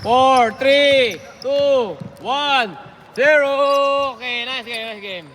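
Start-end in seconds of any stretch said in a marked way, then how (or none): 0.0-4.2 excited countdown. Real, not acted. Counting down final seconds.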